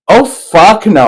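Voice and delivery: retold outburst. Retold refusal in bed.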